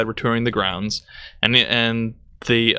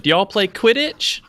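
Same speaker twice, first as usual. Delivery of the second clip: higher, eager clueless tourist question; mocking tourist; 9 semitones higher than usual. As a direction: ask it like a naive tourist. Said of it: "and she went".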